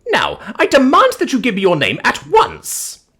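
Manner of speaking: high, loud, imperious outburst; indignant demand. Acted. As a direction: order him like an outraged matron. Dame's final demand for name.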